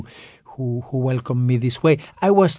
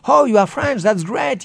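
much higher, bright exclaimed greeting; delighted welcome; 10 semitones higher, 4 dB louder than usual. Retold or imitated. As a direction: imitate a thrilled American host. Americans greeting him warmly, imitated.

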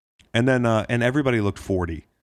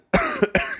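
harsh hacking cough; chesty cough. Real, not acted. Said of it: "You okay with that cough?".

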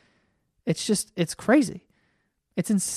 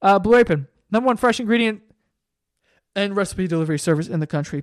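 settled sponsor read, back near normal voice; just read the ad straight; calmer ad read; faster than usual. Shown as a demonstration.

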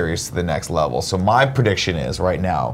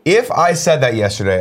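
normal talking voice

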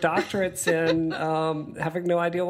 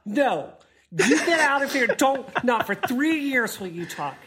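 higher, laughing mock scolding; telling off inner voice; 6 semitones higher than usual. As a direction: shoo away a nagging voice. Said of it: "when that inner demon girl comes back".

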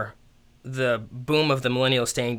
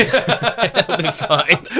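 laughing; 11 semitones higher, 5 dB louder, much slower than usual. Acted. Mock reassurance, laughing.

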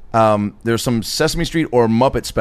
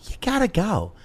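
mom impression; 8 semitones higher, 5 dB quieter than usual. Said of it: "is that an impression of your mom".

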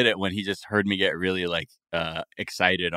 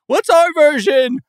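yelling exec; 10 dB louder than usual. Acted. Acted exec pitching, yelling.

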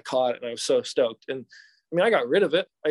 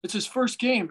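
begging fan; 8 semitones higher than usual. Retold or imitated. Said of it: "He's like".

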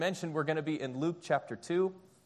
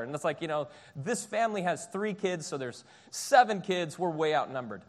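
ordinary talk, nothing marked

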